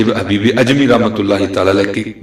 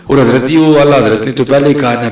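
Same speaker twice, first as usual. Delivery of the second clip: higher and louder, fervent preaching; preaching at full force; 5 semitones higher, 7 dB louder than usual. Acted. Preacher to his congregation, full-force sermon voice. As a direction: lift the congregation with every name.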